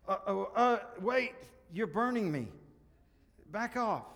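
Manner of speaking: high, loud alarmed protest; yelping in acted alarm; panicked ghost. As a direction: recoil as if scorched, voice leaping up. Ghost recoiling from burning angel.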